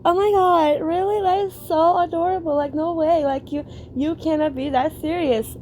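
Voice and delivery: high, put-on gushy voice; mocking fake-sweet enthusiasm; fake gushing. Shown as a demonstration. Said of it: "The fakeness?".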